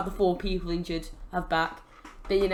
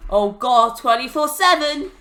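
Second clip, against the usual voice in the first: louder, rising acted cry; acted alarm; 4 semitones higher, 6 dB louder than usual. Referee spots the title pin, mock alarm. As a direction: call it out like a startled referee.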